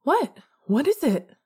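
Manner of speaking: high, loud startled question; sudden alarm. A narrator's reading. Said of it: "starting to panic".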